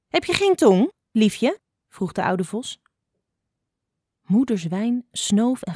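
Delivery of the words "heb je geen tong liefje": high, swooping, needling tone; sly, pitched-up fox taunt; mocking question. A narrator's reading. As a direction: tease with false sweetness. Fox needles the silent mother.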